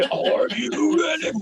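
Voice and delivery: high, slow, drawn-out rock-show cry; rock singer intro. Retold or imitated. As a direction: ham up the metal band's opening howl. Imitating Korn's song intro.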